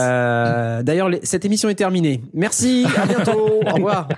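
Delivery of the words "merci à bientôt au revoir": high, hasty joke goodbye; host jokingly ends the episode early; mock sign-off. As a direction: pretend to end the show right now.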